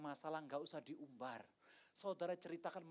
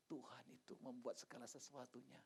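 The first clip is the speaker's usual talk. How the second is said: hushed verse; 3 semitones lower, 11 dB quieter than usual. Retold or imitated. Gentle verse spoken at funeral.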